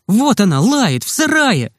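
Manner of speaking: high, drawn-out exultation; triumphant cry. A narrator's reading. Boys prove their point to the nurse, triumphant. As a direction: proclaim it triumphantly. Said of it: "торжествующе закричали мы".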